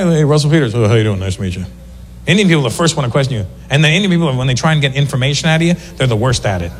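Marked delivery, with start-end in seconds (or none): 0.0-1.6 low, falling, casual greeting. Retold or imitated.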